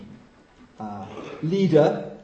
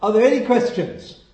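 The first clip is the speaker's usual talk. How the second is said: audacious challenge; 6 semitones higher, 7 dB louder than usual. Retold or imitated. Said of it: "had the audacity to say".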